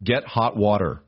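ordinary delivery, nothing marked

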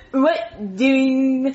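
awful attempt at a puppet kid's voice; badly faked character catchphrase; Jeffy. Shown as a demonstration. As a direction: try and fail at a child puppet voice. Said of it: "let me hear your Jeffy voice".

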